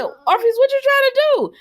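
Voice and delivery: high-pitched incredulous call-out; exasperated disbelief. Acted. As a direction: demand an explanation from the troublemaker, voice soaring. Calling out a TV character.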